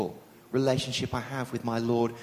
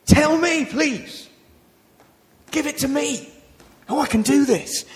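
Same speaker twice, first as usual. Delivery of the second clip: much higher, eager and breathless; excited eager plea; 10 semitones higher, 5 dB louder than usual. Retold or imitated. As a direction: beg to be given the chance, thrilled. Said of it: "he excitedly says this".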